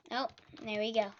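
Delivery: normal talking voice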